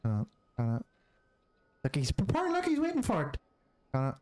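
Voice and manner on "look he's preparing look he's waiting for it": rising excitement, voice shooting higher; excited. Real, not acted. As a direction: pitch climbing into squeals. Excited at game character.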